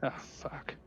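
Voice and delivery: breathy whisper under breath; whispered curse. Real, not acted. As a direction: mutter it to yourself. Player swears under breath.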